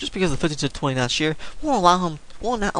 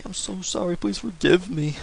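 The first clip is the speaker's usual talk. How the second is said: higher, pleading whiny apology; 5 semitones higher than usual. Shown as a demonstration. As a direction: whine it out like a sulky kid. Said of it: "in that whiny voice".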